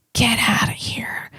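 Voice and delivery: conspiratorial whisper. Acted. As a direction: whisper it like a secret jab. Mocking the HOA guy.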